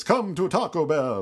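Dracula. Shown as a demonstration. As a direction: invite them in like a vampire count. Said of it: "Or the Dracula voice".

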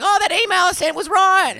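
squeaky know-it-all mum impression; mimicking mum. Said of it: "Mum was like".

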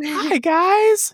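very high squeaky greeting; a failed spooky voice that comes out squeaky; squeaky silly voice. Shown as a demonstration. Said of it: "have it be like this".

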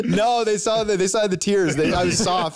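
high laughing reply. Real, not acted. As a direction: laugh through the words. Laughing at a teasing question.

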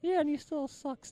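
high, soft puppet voice; heckling puppet. Acted. Ollie the puppet teases his ventriloquist, heckling in a high voice. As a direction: squeak out a puppet's jab, keeping it small.

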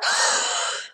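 breathy gasp of fright; big horrified intake of breath. A narrator's reading. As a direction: suck in a startled breath. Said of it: "he held his breath".

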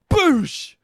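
high loud exclamation; triumphant boosh. Retold or imitated. Swatting a hornet, a high victorious boosh. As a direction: smash it home. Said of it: "and I was like".

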